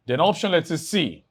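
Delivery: ordinary talk, nothing marked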